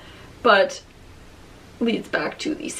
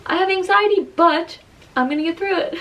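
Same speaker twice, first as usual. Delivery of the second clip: determined; 7 semitones higher than usual. Retold or imitated. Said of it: "put on your big girl pants and say".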